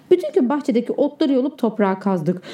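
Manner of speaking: ordinary delivery, nothing marked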